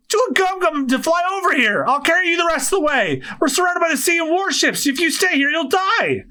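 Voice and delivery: high-pitched, pleading urgency; desperate urging. Acted. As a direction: urge a friend to flee before it's too late. Urgent calls to stranded friend.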